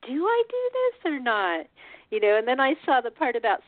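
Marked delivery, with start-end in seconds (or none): 0.1-1.7 slow, high-pitched wondering aloud; mock self-doubt. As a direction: drag it out like you can't decide. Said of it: "'cause I'm thinking".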